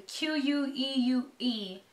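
Q U E U E spelled letter by letter, slowly. Real, not acted. Unsure of the word, spells out Q U E U E letter by letter. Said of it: "I never know how to pronounce this name".

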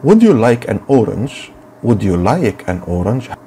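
very slow dialogue line; slow teacher drill; slow model question. Shown as a demonstration. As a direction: read the seller's question slowly. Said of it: "قال لها إيه؟".

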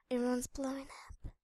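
whispered. Real, not acted. Hiding from TNT player.

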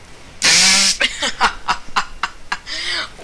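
helpless burst of laughter; rolling run of laughs; long real laugh. Real, not acted. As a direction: crack up at your own blunder. Laughs at failed play.

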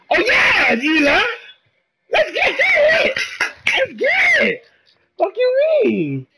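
very high, drawn-out screams of hype; screeching gamer celebration; wild celebration. Real, not acted. Celebrating a play mid-game.

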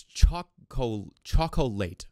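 "chocolate" as three slow, full syllables, CHOC-o-LATE; sounded out for spelling. Shown as a demonstration. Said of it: "Do I not know how to spell chocolate".

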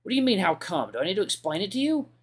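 irritated. Acted. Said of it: "I feel myself get angrier".

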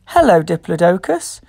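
ordinary delivery

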